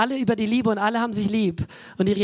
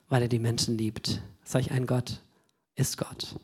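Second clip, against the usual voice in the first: falling from soft speech into an unvoiced whisper on ist Gott; fading to a whisper; 7 semitones lower, 5 dB quieter than usual. Real, not acted. Preacher whispering to the congregation about God, hushed whisper. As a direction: let it fade to a breath. Sermon climax fades to whisper.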